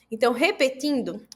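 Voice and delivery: ordinary talk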